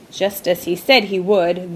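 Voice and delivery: plain reading voice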